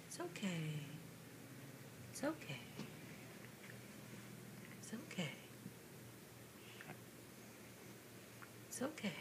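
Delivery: very soft, hushed reassurance. Real, not acted. Mother soothing child.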